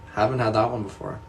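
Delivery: ordinary talk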